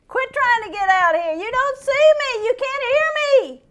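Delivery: high, loud childish mock voice; mock stubborn voice. Preacher mocking a believer ignoring God, high childish. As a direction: play a stubborn child covering its ears.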